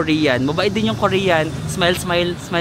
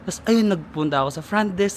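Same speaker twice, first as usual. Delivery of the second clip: ordinary talk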